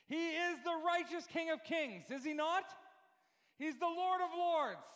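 high, emphatic sermon delivery; impassioned preaching. Real, not acted. Preacher declaring to the congregation who makes him righteous, impassioned preaching. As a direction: proclaim it to the whole church.

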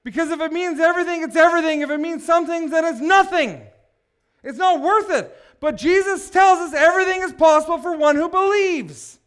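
high, loud, insistent sermon climax; impassioned preaching. Real, not acted. Preacher argues everything means everything, impassioned preaching. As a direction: drive the argument home at full voice. Preacher presses the argument at full force.

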